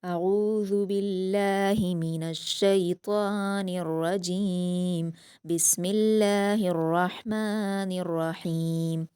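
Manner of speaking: slow, drawn-out tajweed recitation; measured Quranic recitation before class. Shown as a demonstration. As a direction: chant each word slowly and melodically. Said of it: "class को start करते हैं".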